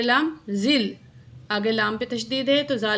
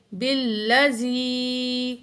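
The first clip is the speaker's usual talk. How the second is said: slow, drawn-out tajweed chant; Quran recitation; much slower than usual. Shown as a demonstration. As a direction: recite slowly with long vowels. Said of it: "اس سے پہلے زال کے نیچے زیر". (آہستہ تجوید کے ساتھ تلاوت)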